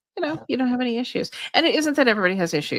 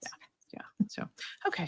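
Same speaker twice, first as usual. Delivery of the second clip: whispering; 13 dB quieter, faster than usual. Real, not acted. Quiet sign-off at end.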